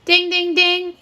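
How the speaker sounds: high, even sing-out dings; imitated bell. Teacher signalling the right answer to children, imitated bell. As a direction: chime like a quiz-show bell.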